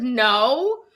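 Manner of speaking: a hint of a wise inner voice replying; inner voice answering. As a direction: lightly voice a knowing inner guide. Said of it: "And I heard".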